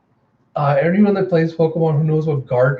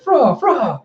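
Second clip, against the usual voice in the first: high, yelped creature noise; pet bark; 10 semitones higher, 4 dB louder than usual. Acted. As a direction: imitate a small robot dog barking. Imitating the robot pet's bark.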